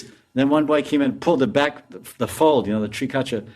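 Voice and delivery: ordinary talk, nothing marked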